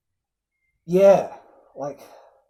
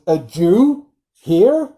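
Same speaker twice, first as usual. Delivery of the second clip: shocked disbelief; 2 semitones higher, 6 dB louder, much slower than usual. Retold or imitated. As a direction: play it as a startled, scandalized question. Blinken's alarmed retold reply.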